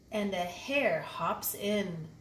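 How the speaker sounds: plain reading voice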